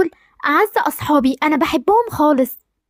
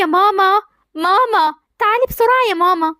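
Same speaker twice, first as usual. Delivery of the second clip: higher, urgent call for mother; 5 semitones higher than usual. Acted. Daughter calls her mother from another room, urgent. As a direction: call mum to come quickly. مالك بتصرخي كده ليه؟.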